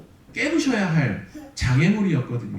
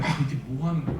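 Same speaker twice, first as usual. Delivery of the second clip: muttered inner complaint; 4 semitones lower, 5 dB quieter, much faster than usual. Retold or imitated. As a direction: imitate grumbling to oneself, lower and softer. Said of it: "막 불평하고 싶잖아요".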